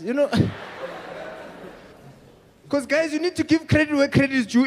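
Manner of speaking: usual speaking voice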